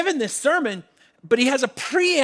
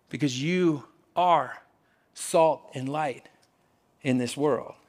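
much lower and softer, very slow; sincere and hushed; 7 semitones lower, 4 dB quieter, slower than usual. Real, not acted. Preacher to the congregation, hushed sincere climax. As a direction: drop right down and let it sink in. Sermon point landing softly.

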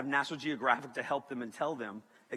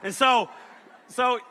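usual speaking voice

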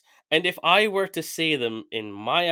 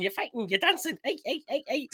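put-on high-pitched caricature of a Scouse accent; mock Scouse; 10 semitones higher, 5 dB quieter than usual. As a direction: imitate rowdy Scousers, voice pitched way up. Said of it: "a couple of Scousers going".

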